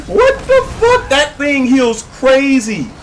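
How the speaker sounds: very high, drawn-out laughing squeal; laughing disbelief. Real, not acted. Player amazed by the crazy healing, laughing shriek. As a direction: yell it slowly through laughter.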